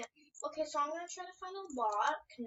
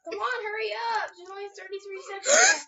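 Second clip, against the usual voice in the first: high, loud frantic cry; frantic crying shout; 6 semitones higher, 9 dB louder than usual. Real, not acted. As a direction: shout it urgently, near tears. Timer running out.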